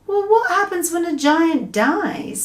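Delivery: put-on a girl asking carefully; little girl voice. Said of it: "Sophie asked".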